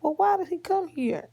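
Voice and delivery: put-on high-pitched granny quizzing the child; fussing high granny; questioning grandma. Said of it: "And she was like".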